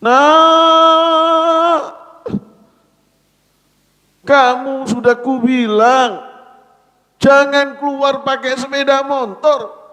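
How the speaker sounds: put-on high, loud, bitter reproach of a dead child; angry wailing scold. Father without knowledge loudly scolds his son who died on a motorbike. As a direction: shout the blame at him through grief. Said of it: "beda lagi".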